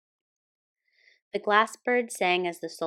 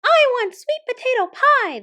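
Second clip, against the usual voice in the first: very high, very loud squeaky shout; shrill mouse tantrum shout; mouse general shouting; 11 dB louder than usual. Acted. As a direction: demand the pie like a furious tiny mouse. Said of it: "the general shouted".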